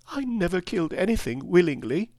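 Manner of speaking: put-on high, plaintive child's voice; tearful protest, lightly acted; sobbing, understated. Weeping girl protests to Oz, understated sob. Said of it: "she sobbed".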